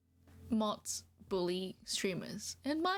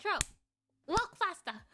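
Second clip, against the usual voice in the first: bossy farm master; 4 semitones higher than usual. Pretend. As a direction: boss the troll like a farmhand you drive with a whip. Bossing troll as farm animal.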